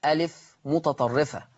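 usual speaking voice

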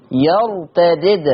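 يرتدد with two separate dals, slowly; first dal with i, second silent in يرتدد; Warsh reading. Shown as a demonstration. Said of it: "الدال الأولى عنده هتبقى مكسورة".

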